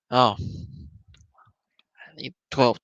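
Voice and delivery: normal talking voice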